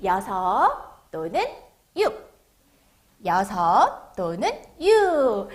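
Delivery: slow, drawn-out number words; read-along model. Shown as a demonstration. Teacher has children read the number six along, slow read-along model. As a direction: leave each word long for children to copy. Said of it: "따라 읽어보도록 할까요".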